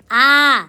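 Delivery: आ held long and steady; sustained open आ; long आ sound. Shown as a demonstration. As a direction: draw out आ as one open vowel for learners. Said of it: "अब आ लिखने का प्रयास कीजिए".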